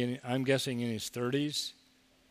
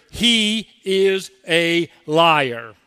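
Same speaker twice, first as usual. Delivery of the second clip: tempter's voice; 2 semitones higher, 9 dB louder, much slower than usual. Acted. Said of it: "Our adversary will whisper".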